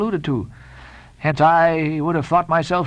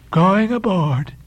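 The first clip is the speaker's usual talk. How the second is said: slow, drawn-out stranger's voice, raised in pitch; Elijah's voice; 4 semitones higher, much slower than usual. A narrator's reading. Elijah accosting the two sailors, slow and odd. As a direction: stretch it out as an odd old prophet.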